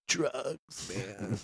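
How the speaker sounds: near-whispered, very soft stoned reply. Retold or imitated. The stoned recruit admits it, nearly whispered. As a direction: breathe it out lazily, almost no voice. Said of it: "he's high as fuck".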